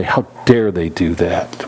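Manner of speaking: slow, low, weighty indignation; lightly acted slow disapproval; mock indignation. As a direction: act out being affronted, drawing the words out. Acting out indignant reaction to others.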